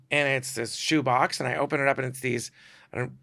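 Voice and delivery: ordinary talk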